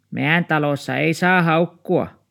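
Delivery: put-on high, loud old woman's voice. A narrator's reading. Downstairs old woman asks about the dog, high and loud. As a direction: pitch up into a fussy old neighbour. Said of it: "Harjamuori puisti sormea".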